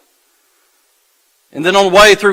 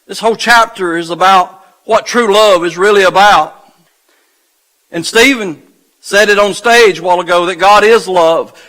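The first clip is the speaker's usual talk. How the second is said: normal talking voice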